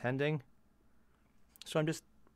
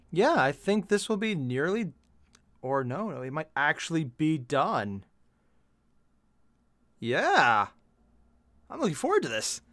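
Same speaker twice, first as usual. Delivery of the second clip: louder excited burst with high pitch leaps; a bit excited; 3 semitones higher, 8 dB louder than usual. Real, not acted. Painter seeing the job is nearly done, excited. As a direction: let real excitement jump into the voice. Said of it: "I'm getting a bit excited".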